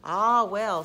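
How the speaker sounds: resigned admiral's sigh. A narrator's reading. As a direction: sigh it out grandly. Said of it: "sighed the Admiral".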